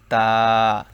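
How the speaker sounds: তা with tongue tip at the upper tooth roots, drawn out; tongue tip to the gum, say তা; ta demo. Shown as a demonstration. Teacher modelling the letter, held demo. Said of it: "যেমন".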